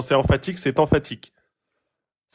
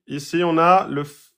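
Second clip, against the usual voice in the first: ordinary talk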